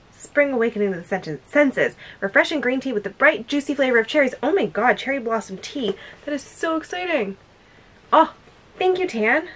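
ordinary talk, nothing marked

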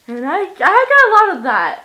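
loud boast. Real, not acted. Bragging randomness.

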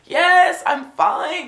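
high, insistent mock outburst; exasperated reply. Acted exasperated reply to repeated greeting.

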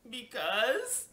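high, rising, hesitant answer; timid reply with a touch of tears; a little tearful. A narrator's reading. Child answers scolding father, slightly tearful. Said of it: "I started to cry a little bit".

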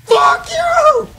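high, loud angry shriek; screamed curse. Real, not acted. Gamer stuck running around, high screamed curse. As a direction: shriek the curse with full force. Cursing an opponent in game.

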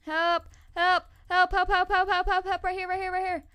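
loud, high cries of help; shouting for help. Real, not acted. Player shouting to teammates for help, loud and urgent. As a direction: call the team over urgently.

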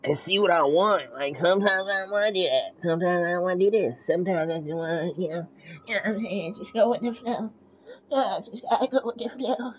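spacey, sing-song and loud; tripping. Real, not acted. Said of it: "I don't know why I'm talking like that".

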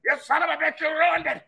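Vince McMahon impression. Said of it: "Vince McMahon saying".

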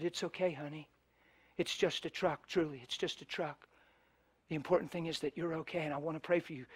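soft, tender reassurance; preacher soothing the woman who hit his truck; gentle reassurance. Retold or imitated. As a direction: comfort her softly like a scared child. Comforting the crying woman.

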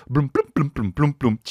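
high plunking "bloom" notes, falling away; vocal imitation of quirky scene music; mock TV music cue. Mocking Grey's Anatomy's music, plinky imitated cue.

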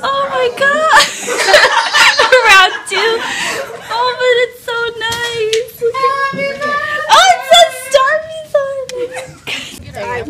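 very high, loud, tearful squealing; overjoyed squeal. Real, not acted. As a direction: shriek with happy, laughing disbelief. Overwhelmed by birthday surprise from classmates.

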